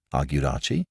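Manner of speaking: ordinary delivery, nothing marked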